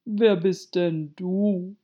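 slow, loud, scornful question; contemptuous caterpillar voice. A narrator's reading. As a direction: draw it out with disdain. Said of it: "sagte die Raupe verächtlich".

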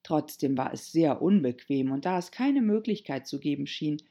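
ordinary delivery, nothing marked

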